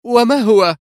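high, loud, edgy demand; nervy question. A narrator's reading. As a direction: ask on edge, voice jumping up. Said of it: "سألته في عصبية". (سؤال عصبي بصوت مرتفع)

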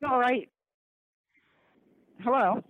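ordinary talk

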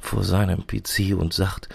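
normal talking voice

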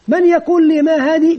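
lifted questioning teacher voice; teacher quizzing pupils. Acted. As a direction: call for a pupil to answer. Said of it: "يمكن مثلًا يقول لهم".

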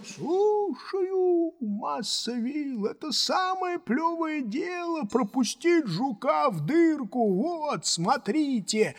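high, drawn-out call; Юпитер, breezily obedient. A narrator's reading. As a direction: answer from above as if it's easy. Plays Jupiter. (протяжно и бодро отзывается)

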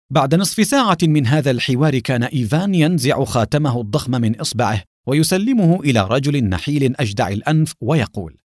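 usual speaking voice

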